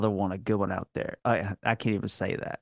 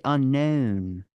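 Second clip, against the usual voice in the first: "unknown" with a slow fronted Baltimore O; drawn-out Baltimore accent on "unknown"; Baltimore long O. Shown as a demonstration. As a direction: stretch the last O of unknown, Baltimore style. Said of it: "it's just the long O".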